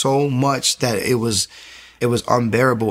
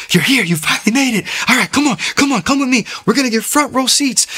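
much higher and louder, bursting with excitement; excited welcome; 12 semitones higher, 3 dB louder than usual. Retold or imitated. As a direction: greet him like a thrilled friend who finally got you to come. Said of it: "this guy was so excited".

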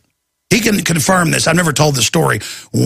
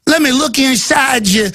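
high cartoonish screener voice; mock a creepy cartoon agent; pitched-up, silly cartoon screener; South Park screener; 8 semitones higher than usual. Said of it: "it was just like the South Park episode".

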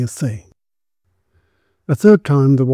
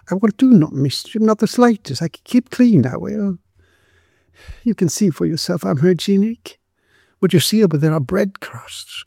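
high, earnest beggar woman's voice; pleading destitute woman; 7 semitones higher than usual. A narrator's reading. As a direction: plead to stay, pitched well above narration.

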